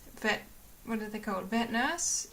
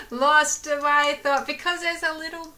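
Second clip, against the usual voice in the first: laughing; 7 semitones higher, 10 dB louder than usual. Real, not acted. Laughs at losing her thought.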